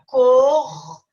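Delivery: "correção" split cor, re, ção, first syllable held. Shown as a demonstration. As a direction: sound out "correção" in three separated beats. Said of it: "na separação de sílaba eles não podem".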